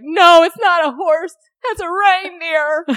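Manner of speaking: put-on high, loud, slow indignant kid; upset child. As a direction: correct her like an outraged five-year-old. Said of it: "Landry was like".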